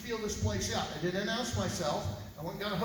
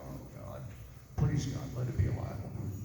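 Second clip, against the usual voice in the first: hushed prayer; 8 semitones lower than usual. Retold or imitated. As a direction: pray under your breath for good news. Praying when the office calls.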